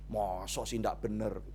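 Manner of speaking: low, quiet, slow skeptical question; a hushed "really?"; soft doubtful aside. Acted. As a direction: murmur it as a gentle challenge.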